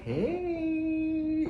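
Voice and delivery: high, drawn-out seductive hey; sexy hey. Retold or imitated. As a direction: stretch it out like a sultry tease. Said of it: "trying to be sexy".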